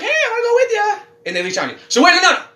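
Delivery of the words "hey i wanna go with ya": very high, eager little ghost voice; clingy ghost. Acted. Host playing a ghost that leeches on, high-pitched. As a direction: pipe up high like a tag-along spirit.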